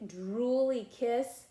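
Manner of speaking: ordinary delivery, nothing marked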